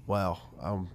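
ordinary delivery

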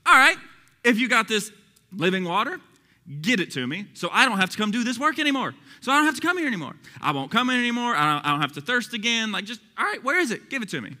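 upset, high and loud snark; mock him with every phrase; fed-up, sarcastic challenge; snarky mocking. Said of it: "She's literally mocking him".

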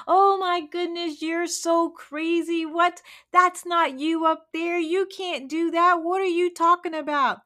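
high, loud, scoffing disbelief; mocking chickens. Chickens scoffing at the eagle's dream, high and mocking. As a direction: scoff at him like he's lost it.

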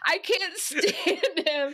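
laughing exasperation; exasperated. Acted. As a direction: drag out each word in mock despair. Acted exasperation at Drew's speeches.

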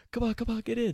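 high, quick hushed urging; urgent hurry. Retold or imitated. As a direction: rush them into the van. Urging friends into the van.

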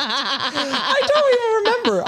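high, laughing answer. Real, not acted. Host recalling the drive-thru mix-up, laughing. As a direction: giggle the words out. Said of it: "laughing while answering".

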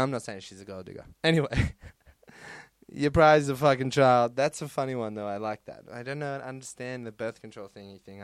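ordinary delivery

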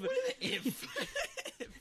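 high, slow squeal through laughter; laughing squeal. Real, not acted. Mocking the word if.

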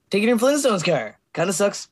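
normal talking voice

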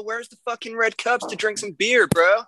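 high-pitched, hammed-up American frat bro; American bro accent. Shown as a demonstration. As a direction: put on an American party-dude voice. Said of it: "Do you guys do American accents?".

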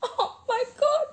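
high laughing exclamation. Real, not acted. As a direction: laugh it out, overwhelmed. Moved by friend's letter.

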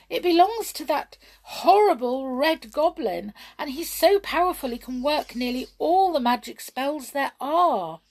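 high, loud, dramatic warning; alarmed. A narrator's reading. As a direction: tell of a villain with dread and urgency. Mermaid warns of the goblin.

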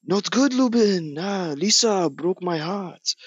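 high, slow, heartbroken put-on voice; hammed-up sad high voice; Hank Azaria impression. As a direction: whine it like a jilted lover. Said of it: "he's like".

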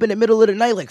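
ordinary talk

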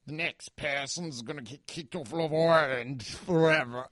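lightly imitated Australian host, island as Ireland; a hint of the Love Island host; Australian host accent. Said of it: "I've never heard an accent like this".

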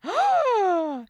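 high, loud theatrical gasp; mock shocked gasp. Listener hears of Dorian's swoon, mock shocked gasp. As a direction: gasp loudly as if scandalised.

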